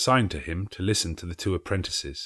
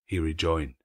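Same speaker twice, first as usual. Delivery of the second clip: ordinary delivery, nothing marked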